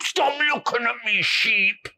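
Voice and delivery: put-on a bored boy snapping at a sheep; cross with the sheep. A narrator's reading. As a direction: tell the sheep off.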